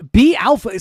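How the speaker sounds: loud, high hype shout; chest-out alpha shout; commanding. Pretend. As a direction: bark it like a gym bro. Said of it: "Say it with your chest".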